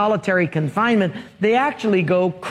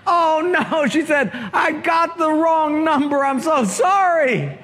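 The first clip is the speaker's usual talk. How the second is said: high, flustered apology; suddenly mortified, rush the sorry; embarrassed dismay; 7 semitones higher than usual. Acted. Caller realizes wrong number.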